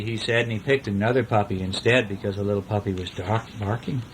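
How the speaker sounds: ordinary delivery, nothing marked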